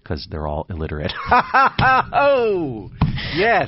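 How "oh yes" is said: squealing high laughter; high shrieking laugh. Real, not acted. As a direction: laugh loud and high, then gasp. Big laugh after joke.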